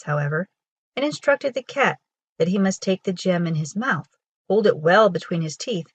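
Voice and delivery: usual speaking voice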